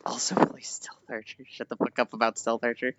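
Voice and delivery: low, hushed grumble; muttered aside. Real, not acted. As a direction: mutter it under your breath, nearly whispered. Mutters chat message and retort.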